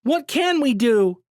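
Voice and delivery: high, loud, slow despairing cry; desperate cry. A narrator's reading. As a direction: cry out helplessly. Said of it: "another voice cried".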